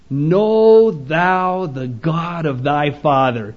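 slow, weighty King James reading; stately slowed scripture quote; solemn slow charge. Retold or imitated. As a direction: draw each word out like a father's charge. David's charge quoted slowly.

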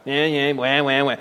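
low, dismissive mock whine; mock baby wailing. Preacher mocks atheists' objection to God, mock baby whining. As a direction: wave it away with fake baby cries. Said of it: "it's such a baby mentality".

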